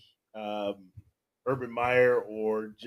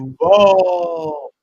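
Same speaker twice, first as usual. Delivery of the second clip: a loud, drawn-out, eerie "Duval"; mock the new coach's creepy delivery; creepy Duval impression; 2 semitones higher, 10 dB louder than usual. Co-host mimics the coach's creepy Duval, loud and eerie. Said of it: "that was a good take on it".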